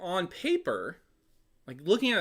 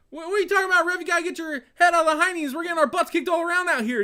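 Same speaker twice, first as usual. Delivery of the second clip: much higher, louder and fast whiny complaint; Phillip Rivers whining at the ref; 13 semitones higher, 6 dB louder, much faster than usual. Retold or imitated. Quarterback complaining to the ref, high, loud, fast whine. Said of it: "goes up to refs, like".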